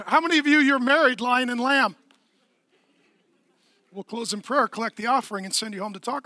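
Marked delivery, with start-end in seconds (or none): none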